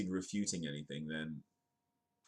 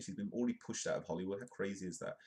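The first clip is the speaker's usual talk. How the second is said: normal talking voice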